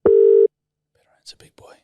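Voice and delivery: hushed urging; whisper. Real, not acted. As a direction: whisper it to the phone. Whispering while phone rings.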